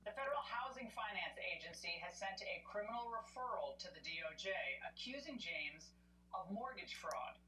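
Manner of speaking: lightly teasing news read; tabloid tv host. Acted. News host reading story.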